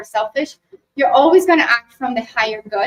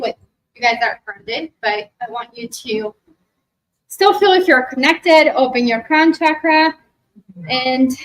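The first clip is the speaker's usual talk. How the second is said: guided meditation. Acted. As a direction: guide them gently into the memory. Leads a meditation.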